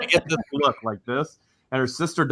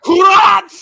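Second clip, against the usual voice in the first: very high, loud shout; yelled what; 12 dB louder than usual. Retold or imitated. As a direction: bark it out at full force. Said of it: "And then it's".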